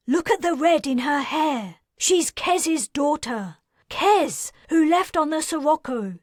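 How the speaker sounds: high, loud, insistent argument; urgent pleading. Acted. Wilder pressing the leader to believe her, high and urgent. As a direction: make them recognize the girl.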